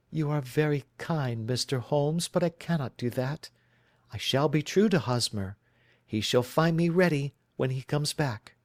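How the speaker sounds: a worried bride telling her story; anxious young woman. Acted.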